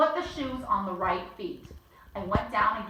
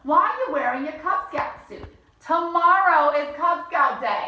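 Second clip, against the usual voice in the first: mother scolding; 6 semitones higher, 8 dB louder than usual. Acted. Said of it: "said my mother".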